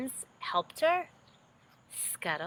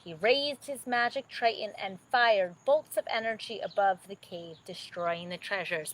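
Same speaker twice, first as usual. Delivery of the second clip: usual speaking voice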